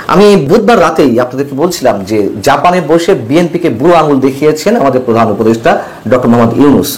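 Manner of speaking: usual speaking voice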